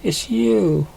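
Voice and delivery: high, delighted recognition; light and glad at seeing him; joyful surprise at an old friend; happy cry. Acted. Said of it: "she cried happily".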